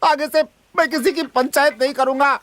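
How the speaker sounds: high-pitched, huffy outburst; fed-up, agitated headman; angry dismissal. Acted. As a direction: chase them off in a temper. Headman chasing the complainant off.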